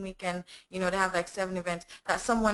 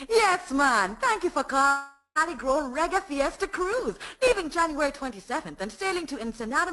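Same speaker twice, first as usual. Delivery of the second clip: higher, upbeat Jamaican radio announcer; Jamaican presenter; 7 semitones higher than usual. Acted. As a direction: sell the cruise like a Jamaican reggae radio host. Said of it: "like, a Jamaican presenter voice".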